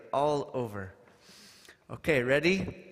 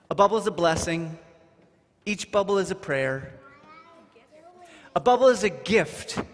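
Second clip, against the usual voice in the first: rising, louder blessing lines; ceremonial recitation; 5 semitones higher, 6 dB louder than usual. Acted. Leader reciting bubble blessings to the group, rising. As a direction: proclaim each line like a blessing.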